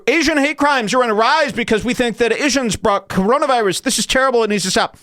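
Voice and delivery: high-pitched mocking recital; mock alarm. Quoting what they're saying about hate crimes, mock alarm. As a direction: recite it high, as overblown concern.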